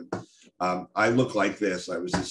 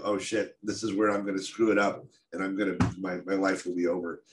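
ordinary delivery

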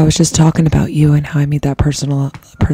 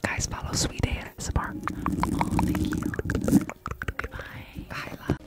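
whispering; 10 dB quieter than usual. Real, not acted. ASMR live, whispered greetings.

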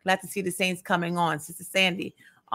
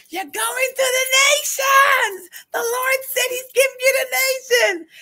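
prophetic shout; 5 dB louder than usual. Retold or imitated. Imitating Mother prophesying over someone in the prayer circle, a high crying shout. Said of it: "Mother like".

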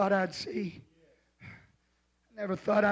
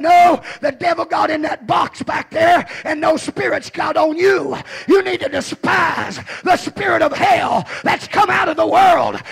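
loud, higher preaching rebuke; retold pastor's rebuke; 6 semitones higher, 9 dB louder than usual. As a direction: scold the member from the pulpit. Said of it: "I said".

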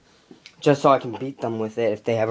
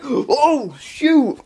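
startled; 12 semitones higher than usual. Retold or imitated. Friends spotting the costumed kid, yelping in fright. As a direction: yelp as if caught off guard. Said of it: "They just go like".

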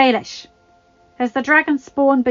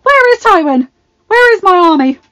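very high, loud frantic yell; panicked yell; 12 semitones higher, 19 dB louder than usual. Acted. Joffrey panicking before the council, yelling. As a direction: scream for your army.

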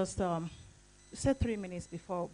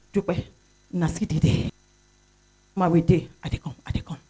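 louder Pidgin call, ending unvoiced; Pidgin phone call; 9 dB louder than usual. Member calling during the meeting, saying she's coming, Pidgin. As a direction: hurry as you call her back. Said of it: "and she would say".

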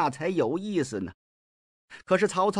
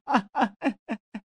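a short, higher fake sob that falls away; mock sobbing wail; 6 semitones higher than usual. Mi Heng sobs mockingly before Xun Yu, short wail. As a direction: squeeze out a theatrical sob. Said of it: "哭什么劲儿啊".

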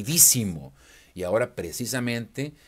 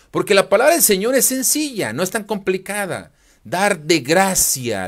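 loud, furious preaching; angry shout; 7 semitones higher, 8 dB louder than usual. Real, not acted. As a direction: hurl the accusation at him, loud and high. Preacher denouncing a rival pastor. (grito furioso de predicador)

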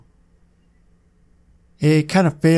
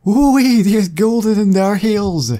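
put-on higher, louder old-timey Western prospector accent, slow; hammed-up prospector drawl; 6 semitones higher, 3 dB louder, slower than usual. Shown as a demonstration. Redoing the line as an old-timey Western prospector accent. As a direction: whoop it like a gold-crazed prospector in a Western accent. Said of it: "No, let me try that again".

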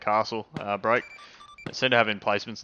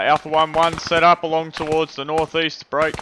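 raised voice giving orders under fire; loud commands; 4 semitones higher, 6 dB louder than usual. Real, not acted. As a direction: call out the orders over gunfire. Squad leader orders under fire.

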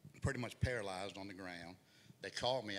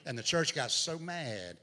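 loud, driving sermon delivery; preaching at full force; 4 semitones higher, 7 dB louder than usual. Acted. Pastor preaching his daughter's hospital story, loud and driving. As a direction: push the story out to the congregation.

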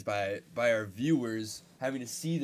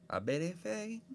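high, soft teasing singsong; mocking heckle; 9 semitones higher, 4 dB quieter than usual. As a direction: needle a friend in a high voice. Mocking challenge of friend's watch.

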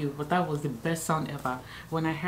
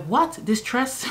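shocked disbelief; 8 semitones higher, 3 dB louder, slower than usual. Retold or imitated. Mother hears baby in distress.

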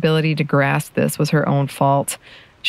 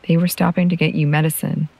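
ordinary delivery, nothing marked

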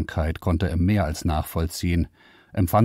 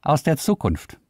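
toneless; 9 semitones higher than usual. Acted. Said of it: "erklärte der Computer tonlos".

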